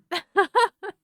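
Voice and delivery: high bright laugh breaking out; burst of laughter. Real, not acted. Laughing after event story.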